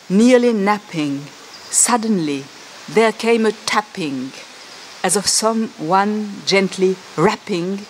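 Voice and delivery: slow, measured English reading of Poe; poem recital. Shown as a demonstration. Lecturer reading Poe aloud to show its sounds, slow recital. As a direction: savour the sounds, line by line. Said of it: "c'est les sonorités".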